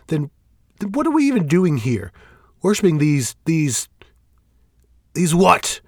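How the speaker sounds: rising from firm to loud, high outrage; building fury. Acted. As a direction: build the rage line by line. Shane escalating against Fel.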